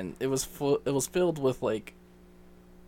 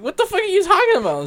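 loud, very high, fast protest; baffled outburst; 10 dB louder, faster than usual. Retold or imitated. Son protesting dad's racism charge, baffled outburst. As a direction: blurt it out in a high incredulous yell.

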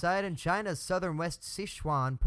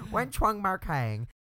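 mock Chinese accent; 4 semitones higher, 5 dB louder, slower than usual. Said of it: "I think we're being a little racist".

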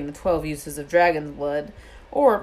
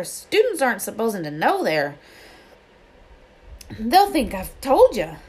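higher, louder urgent giant voice; urgent Hagrid; 4 semitones higher, 4 dB louder than usual. Acted. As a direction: hurry them off, nervous and urgent. Hagrid urging secrecy.

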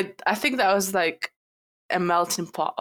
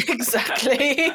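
ordinary talk, nothing marked

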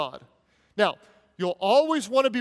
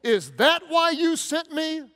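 crying out to God; 6 semitones higher, 4 dB louder than usual. Retold or imitated. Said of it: "he cried out".

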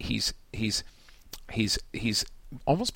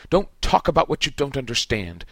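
shouting; 4 semitones higher, 6 dB louder than usual. A narrator's reading. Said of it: "Snape shouted".

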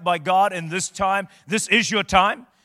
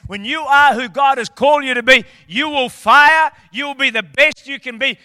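preaching surge; 5 semitones higher, 7 dB louder than usual. Real, not acted. Pastor firing up the congregation, louder. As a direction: lift it up to the word fire.

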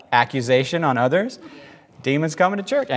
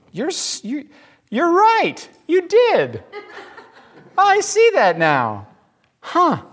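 sudden realization; 13 semitones higher, slower than usual. Retold or imitated. Realizing Jesus was right.

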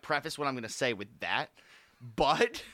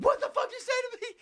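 much higher mock-angry voice; imitating angry guy. Retelling what the man said to Frankie, mock-high angry voice. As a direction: ham up the guy's reaction. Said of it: "the guy, like, was like".

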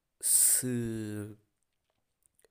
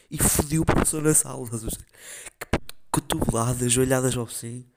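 louder, fired-up storytelling; excited rant; 4 semitones higher, 12 dB louder than usual. Real, not acted. Retelling beating up the teacher, loud and worked up.

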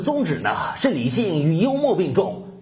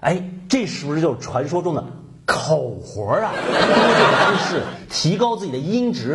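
usual speaking voice

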